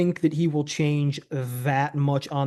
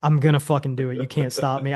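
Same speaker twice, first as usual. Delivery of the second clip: usual speaking voice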